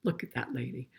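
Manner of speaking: ordinary delivery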